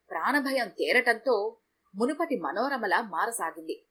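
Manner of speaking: ordinary delivery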